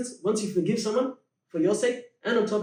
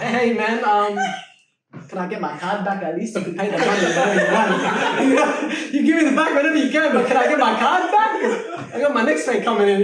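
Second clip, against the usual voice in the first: higher, louder, laughing mock plea; pleading with a laugh; 5 semitones higher, 7 dB louder than usual. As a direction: beg for the card back while laughing at it. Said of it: "The next day, I'll be like".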